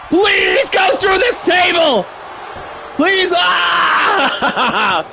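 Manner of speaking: slow, high shout hitting this in Please go through this table; pleading fan yelling at the screen; desperate begging shout. Real, not acted.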